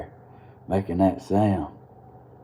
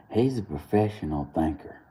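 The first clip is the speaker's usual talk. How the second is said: ordinary delivery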